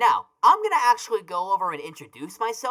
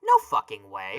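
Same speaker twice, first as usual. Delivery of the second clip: disbelief; 13 semitones higher than usual. Acted. Disbelief at the news.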